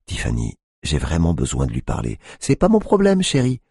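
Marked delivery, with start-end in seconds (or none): 2.4-3.6 much higher, louder sneer; mocking dismissal. A narrator's reading. Tiffany dismissing the cop, high mocking. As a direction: brush him off with fake sweetness.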